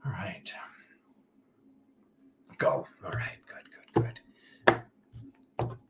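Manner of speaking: soft unvoiced whisper. Real, not acted. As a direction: breathe the words, barely there. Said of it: "I don't know why I'm whispering".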